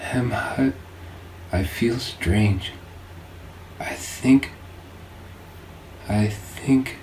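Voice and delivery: soft, slow, breaking into a whisper; fading, dying. A narrator's reading. Lylin fading away in Hemhut's arms, dying whisper. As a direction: let the life drain out of the words. Dying woman's last words.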